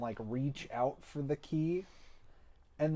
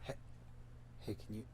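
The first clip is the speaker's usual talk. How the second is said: whisper; 4 semitones lower, 15 dB quieter, much faster than usual. Pretend. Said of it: "to whisper in Solvang's ear".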